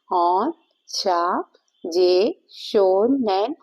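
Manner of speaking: very slow, syllable by syllable; drawn-out symbol name. Shown as a demonstration. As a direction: stretch each syllable while tracing the symbol. Spells out symbol name while drawing.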